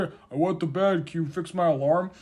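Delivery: put-on sleepy kid to mom.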